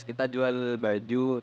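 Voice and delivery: normal talking voice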